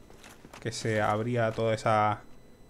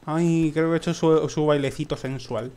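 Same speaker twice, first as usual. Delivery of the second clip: usual speaking voice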